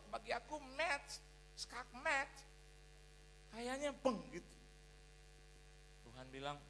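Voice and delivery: usual speaking voice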